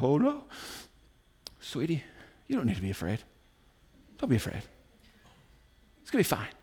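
soft, low comforting murmur; imitating a parent gently reassuring a scared child; soothing parent. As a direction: hush it down tenderly. Parent soothing frightened daughter at camp.

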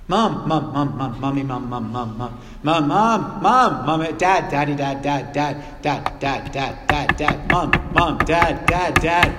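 put-on relentless nagging kid, words repeated rapid-fire; pestering child. Child who won't stop asking mom and dad, pestering. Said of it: "what kind of people do that? Children".

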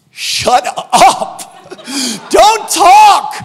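very high, loud mock yell; comic shouted command. Preacher telling listeners how to respond, comic shouting. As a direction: yell it out as a blunt punchline.

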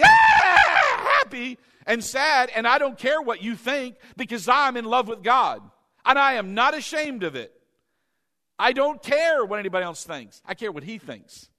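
loud, high, carefree joy; exuberant believer. Acted. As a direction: shout it out like you're in love with God. Preacher acts out exuberant believer.

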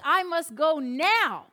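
drawn out with heroic resolve; mock solemn urgency. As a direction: Peter hammed-up resolute. Peter can't wait to reach Jesus.

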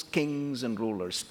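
normal talking voice

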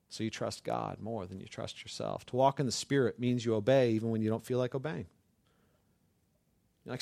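ordinary delivery

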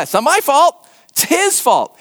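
acted indignant denial; protesting innocence. Healed man protesting his innocence.

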